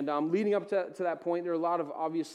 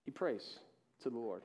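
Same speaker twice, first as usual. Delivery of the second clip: much softer, lower echo; hushed repeat; 6 semitones lower, 10 dB quieter than usual. Shown as a demonstration. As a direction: drop almost to a hush on the repeat.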